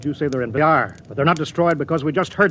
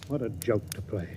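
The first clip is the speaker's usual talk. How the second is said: bitter laugh; 5 semitones lower, 14 dB quieter than usual. Acted. Captain laughs bitterly at the idea.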